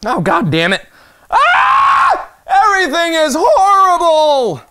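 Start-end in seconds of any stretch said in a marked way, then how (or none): 1.3-2.3 high, loud scream of frustration; piercingly high mock-anguished scream; frustrated scream. Frustrated scream at missing the target. 2.5-4.6 high, slow mock-despair wail; hammed-up wail of despair; anguished wail. Wailing after missing in the game.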